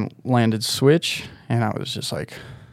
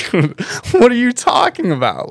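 way higher, incredulous; mock disbelief; 12 semitones higher, 4 dB louder than usual. Answering Bryden's suggestion to try another trick, mock disbelief. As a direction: act baffled, like it's an absurd idea. Retelling his reply to Bryden.

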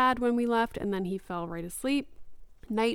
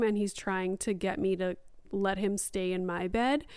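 ordinary delivery

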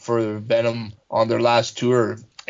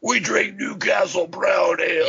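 mock metal-singer proclamation; high-pitched, hammed-up stage shout; 7 semitones higher than usual. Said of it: "like, [name], their singer".

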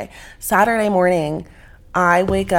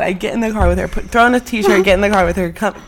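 normal talking voice

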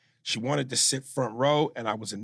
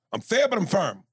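higher, louder mock referee declaring "fair" and "firm"; referee catchphrase; 9 semitones higher, 4 dB louder than usual. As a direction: ham up the boxing ref's stern motto. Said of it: "He always goes".

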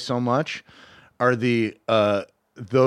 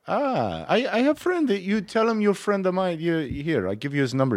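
put-on higher, halting Persian-accented English; Persian guy; 6 semitones higher than usual. Persian worker offering a friend's number, imitated Persian accent. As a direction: imitate a helpful Persian coworker. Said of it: "everyone was Persian".

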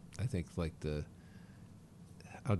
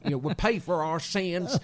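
slow, louder doomsday proclamation; mock doom preacher; 5 semitones higher, 5 dB louder, slower than usual. Bible-carrying Texan woman proclaiming doom to passengers, slow hammed-up preaching. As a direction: ham up a Bible-thumper's warning.

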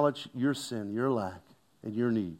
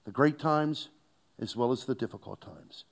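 ordinary talk, nothing marked

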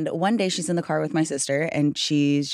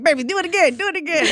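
1 eager aunt; 10 semitones higher, 4 dB louder, faster than usual. Retold or imitated. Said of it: "I was like".

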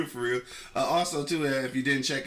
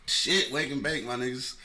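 usual speaking voice

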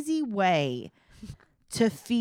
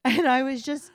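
ordinary talk

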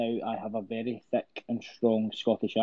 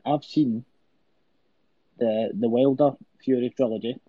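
normal talking voice

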